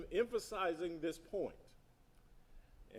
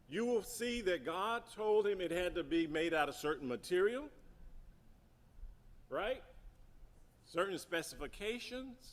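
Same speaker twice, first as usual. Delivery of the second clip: normal talking voice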